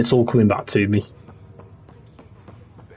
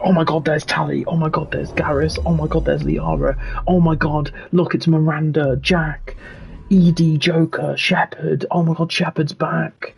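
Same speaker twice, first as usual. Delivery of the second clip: higher, breathless fan squealing over each name; mock gushing fan; 6 semitones higher than usual. Mocking fans who only want old characters, hammed-up gushing. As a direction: gush like a starstruck fan.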